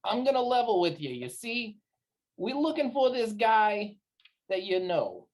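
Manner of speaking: lightly put-on streetwise voice; level with them man to man; folksy wise guy. [name] asks recruiter after a man, confidingly folksy.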